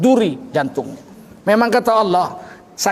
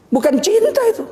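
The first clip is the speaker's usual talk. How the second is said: emphatic denial. Acted. Preacher denies it loudly.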